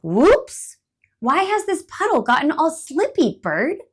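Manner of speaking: surprised squirrel. Acted. Startled slipping squirrel. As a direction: squirrel slips on frozen puddle, startled.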